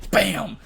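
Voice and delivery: loud, punchy "bam"; imitated gunshot. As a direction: burst out with a shot sound. Imitating a sudden shot.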